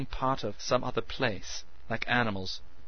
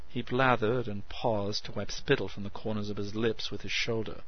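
ordinary delivery

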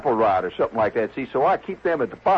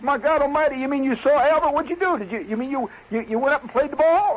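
high-pitched, stammering disbelief; aghast; 11 semitones higher than usual. Acted. Club member learns guest played beside the alligator, aghast. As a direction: pile up questions in horrified amazement.

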